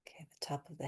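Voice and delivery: soft unvoiced whisper. Real, not acted. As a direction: breathe the cue out in a whisper. Whispered cue mid-tapping.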